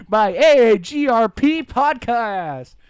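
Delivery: loud, slow booming outro announcer. Pretend. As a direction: boom it out like a radio promo. Mock show outro announcer.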